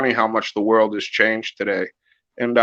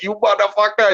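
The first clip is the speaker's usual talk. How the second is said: imitating Duran; 7 semitones higher than usual. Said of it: "He'd be like".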